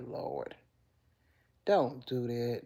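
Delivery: ordinary talk, nothing marked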